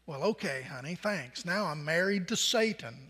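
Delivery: flatly sarcastic, thanks a lot; sarcastic wife muttering her thanks; deadpan wife. Acted. Wife's deadpan reply.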